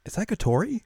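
high, astonished mock voice; surprised girls. The group recognises Kotori in a high, surprised voice. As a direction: gasp it out in disbelief.

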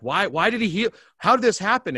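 high, loud, mock-frantic questions; imitating tearful questioning. Healed guest assailing the pastor with questions, imitated astonishment. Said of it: "assaulting me with all these questions".

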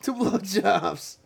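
drawn-out high toast; high slow toast. Pretend. Toast echo.

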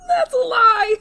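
high, loud laugh through the words; laughing hard. Real, not acted. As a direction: crack up while speaking. Cracks up at own remark.

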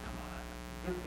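very hushed, barely voiced protest; reluctant mutter. Preacher retells balking at God's request, hushed reluctant mutter. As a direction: grumble it under your breath.

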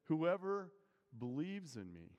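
preacher leaning hard on "Whoever"; stress on whoever. Retold or imitated. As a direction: punch "Whoever", let the rest fall. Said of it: "He says".

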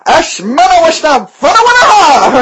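high, loud nonsense gibberish in an invented tongue; made-up language. Shown as a demonstration. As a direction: scold a kid in playful fake-language babble. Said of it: "I speak in my own language".

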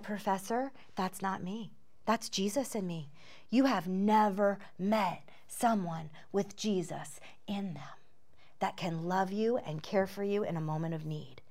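low, hushed and sincere; soft, measured words of quiet reassurance; gentle, earnest. Retold or imitated. Retold gentle answer to professor's confession.

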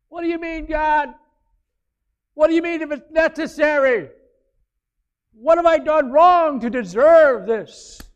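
high, loud pleading at God; distressed believer. Retold or imitated. A troubled believer protests to God, high and loud. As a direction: cry out hurt and confused.

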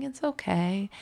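softly retold comforting murmur; soothing. Father rocking his upset son, soothing. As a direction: imitate a parent softly calming a crying child. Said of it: "rocking him and rubbing his back".

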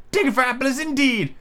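high, indignant character voice; indignant high-voiced master; angry Rabbit. A narrator's reading. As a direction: throw it back crossly, pitched well up. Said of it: "said the rabbit angrily".

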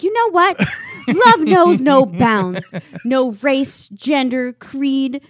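loud, slow, declaimed like a righteous speech; mock indignant speech. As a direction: proclaim it to a whole cabin, hammed up. Retold outraged speech to the plane.

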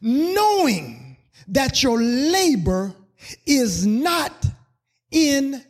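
loud, high, stretched preaching of the verse; preached scripture reading. Acted. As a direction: proclaim each word to the church.

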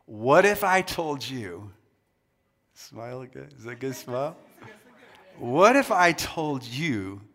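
usual speaking voice

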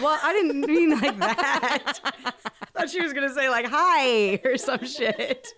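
loud, high voice through real laughter; cracking up, words stretched out by laughing; laughing hard. Real, not acted. Host reacting to a guest's sudden scream, laughing hard.

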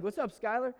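put-on high, bright hey to an old friend passing by; cheery call-out. Jogging past his estranged friend, a loud cheery greeting. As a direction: toss the greeting out as you run past.

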